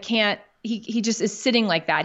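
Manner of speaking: usual speaking voice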